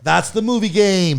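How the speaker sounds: high, loud, stretched-out host outro; show sign-off. Pretend. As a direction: belt out the end of the segment. Host's sign-off for the game segment.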